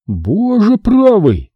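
breathed awe. Acted. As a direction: Bet amazed at the gadget, breathing it out slowly. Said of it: "выдохнул Бэт".

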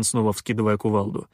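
ordinary delivery, nothing marked

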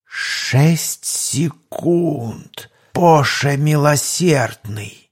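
slow, low thug drawl; gang boss voice. Acted. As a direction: stretch it out like a tense gangster. Бандит-главарь, медленно, чужой голос. (голос главаря банды)

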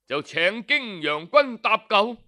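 ordinary delivery, nothing marked

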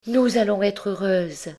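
high, bright burst of joy; joyful cry. Acted. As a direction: cry it out clapping with delight. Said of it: "battre des mains et à rire et à crier". (cri de joie aigu)

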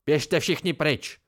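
slow, drawn-out bellow at everyone; furious roar to clear the room. Acted. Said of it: "zařval Ambrose".

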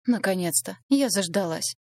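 usual speaking voice

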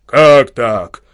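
loud bellowing outrage; a big peasant bellows his protest; roaring. Acted. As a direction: roar at him, stamping. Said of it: "притопнув, заревел".

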